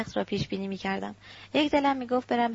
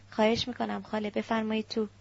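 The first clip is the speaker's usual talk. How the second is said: plain reading voice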